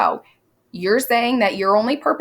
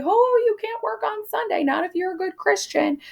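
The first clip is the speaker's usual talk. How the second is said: mock pious scold; 12 semitones higher than usual. Teacher mocks Parris's complaint.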